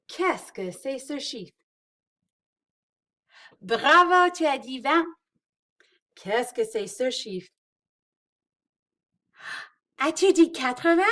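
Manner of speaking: bright quiz with big praise; talking to toddlers. Acted. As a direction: cheer each right answer like a preschool teacher. Quizzing kids, praising answers.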